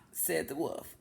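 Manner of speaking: plain reading voice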